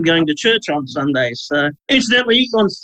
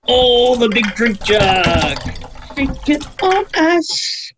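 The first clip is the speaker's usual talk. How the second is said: stretched slow words, higher; slow drawn-out voice; 5 semitones higher, much slower than usual. Pretend. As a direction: drawl it out slowly. Slow drawn-out voice over drink.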